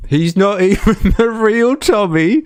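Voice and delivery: high, loud laughing words; a streamer laughs at Tommy, high and loud. Real, not acted. Laughing through the words.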